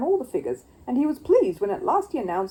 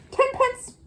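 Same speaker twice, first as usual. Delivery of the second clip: high eager bid; cried out; 4 dB louder than usual. Acted. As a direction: blurt out the tiny bid. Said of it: "cried Paddington".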